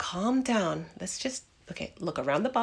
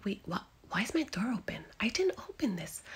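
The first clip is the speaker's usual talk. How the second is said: fast frightened whisper; scared whisper; 5 dB quieter, much faster than usual. Acted. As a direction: rush it out under your breath. Finds door open, scared.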